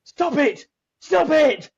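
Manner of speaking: a fan's exasperated mock plea at the screen.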